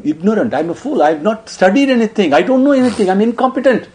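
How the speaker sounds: put-on a young boy begging his elder not to crown him; pleading through tears. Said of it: "He was crying non-stop".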